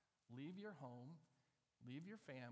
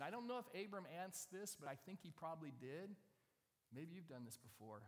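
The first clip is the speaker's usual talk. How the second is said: ordinary delivery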